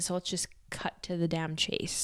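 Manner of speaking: whispered, unvoiced and soft. Real, not acted. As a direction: drop into a whisper. Sudden whisper after the announcement.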